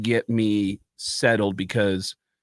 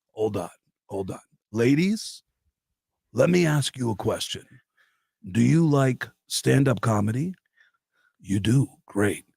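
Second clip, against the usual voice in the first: lightly voiced Gene working the room; [name] voice. Imitating Gene addressing strippers.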